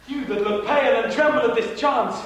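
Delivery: loud, high, commanding address; loud declaiming. Acted. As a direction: proclaim it to the whole court. Hamlet addresses the court.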